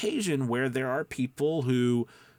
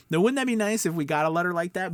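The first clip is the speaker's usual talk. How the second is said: excited; 7 semitones higher, 5 dB louder, faster than usual. Real, not acted. Gleeful rhetorical question.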